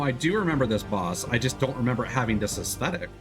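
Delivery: usual speaking voice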